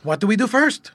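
put-on high, eager little boy; piping up. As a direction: chirp the question up brightly. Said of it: "Marmaduke piped up".

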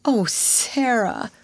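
slow, drawn-out wail of dismay; reproachful wail. A narrator's reading. Ermengarde dismayed at Sarah's mistake, slow wail. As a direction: stretch it out in hurt reproach. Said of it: "almost wailed in her reproachful dismay".

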